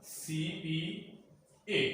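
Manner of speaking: "CPA" very slowly, each letter apart. Shown as a demonstration. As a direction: spell out "CPA" softly with gaps between C, P and A. Teacher spelling out the abbreviation.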